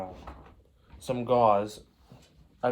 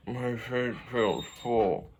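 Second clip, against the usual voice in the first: mouth full; 3 dB quieter, slower than usual. Retold or imitated. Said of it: "you're gonna be like".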